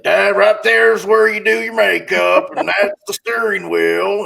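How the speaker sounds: condescending high mock voice talking down; sneering mock-salesman voice. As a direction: patronize her like a dim child. Condescending salesman mocking woman.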